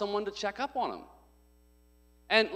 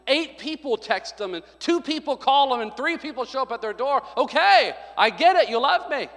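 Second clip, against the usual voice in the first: excited mock relief; 8 semitones higher, 8 dB louder than usual. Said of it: "if they're gone".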